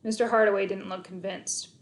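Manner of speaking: ordinary delivery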